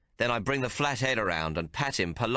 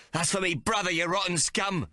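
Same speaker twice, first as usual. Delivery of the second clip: panting; 9 semitones higher than usual. Acted. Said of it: "I pant".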